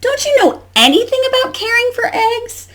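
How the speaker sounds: high-pitched indignant duck scolding. Acted. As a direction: scold in a high storybook squawk. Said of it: "Duck scolded".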